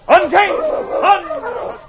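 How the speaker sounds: loud high musher's call; calling out to dogs. Acted. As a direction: shout commands to the sled dogs. Musher calling to dog team.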